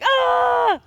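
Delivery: short high shriek; mock a girl crying out; upset girl's shriek. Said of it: "She's like".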